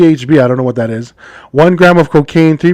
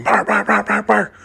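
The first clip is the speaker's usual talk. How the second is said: higher, quiet yapping bark; barking dogs; 5 semitones higher, 5 dB quieter than usual. Acted. Joke teller playing police dogs at a tree, barking. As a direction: imitate dogs with quick repeated barks. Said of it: "the dogs are barking".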